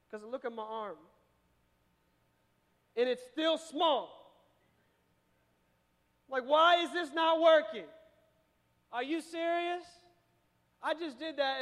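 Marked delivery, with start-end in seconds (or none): 3.0-11.6 loud frustrated outburst; getting mad. Pretend. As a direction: yell it like a fed-up guy at the gym. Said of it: "And now I'm getting mad".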